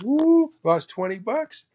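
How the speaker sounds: high, gleeful whoop to start; excited Mo retelling his loss. Retold or imitated. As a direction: crow about the bet like Mo. Mo quoted excitedly after round.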